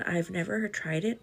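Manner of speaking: ordinary delivery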